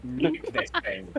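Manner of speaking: high, urgent push; excited urging. Real, not acted. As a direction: egg them on, pitch up. Urging an all-out attack.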